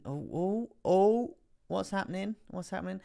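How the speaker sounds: high, rising oohs and questions; high-voiced alarm, easing in volume; startled surprise. Real, not acted. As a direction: pitch it way up as things suddenly move. Streamer reacts as price suddenly moves.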